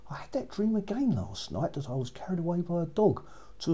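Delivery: high, hushed princess whisper; breathless young princess, voice lifted and hushed. Acted. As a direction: whisper the dream like a secret, pitched high. Princess tells dream to parents.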